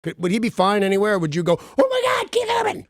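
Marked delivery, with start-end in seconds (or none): 1.8-2.9 much higher, shrieking mock fan; starstruck fan. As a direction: squeal it like a fan spotting a star.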